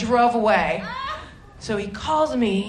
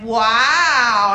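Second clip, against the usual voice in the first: loud drawn-out sarcastic wow; mock him with a sneering wow; punchy sarcastic heckle wow; 7 dB louder than usual. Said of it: "a really sarcastic, like".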